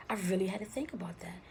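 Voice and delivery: ordinary delivery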